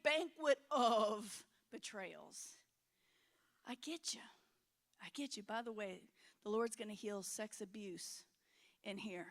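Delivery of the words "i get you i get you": whispered reassurance. Real, not acted. Pastor whispering comfort to wounded listeners. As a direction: lean in and whisper it to them.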